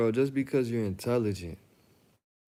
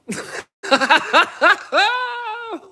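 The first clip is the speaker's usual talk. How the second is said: high shrieking laugh, much louder; laughing hysterically; 11 dB louder than usual. Real, not acted. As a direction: crack up in a screech of laughter.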